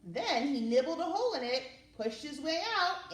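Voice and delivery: put-on reading a picture book to kids; lively read-aloud with rising phrases; storyteller voice for children; story narration.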